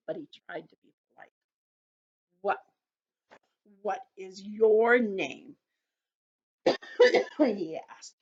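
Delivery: put-on gentle storybook narration for kids; children's story reading. A narrator's reading. Teacher reading a story to children, gently. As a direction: read it to the circle like a picture book. Reads picture book to children.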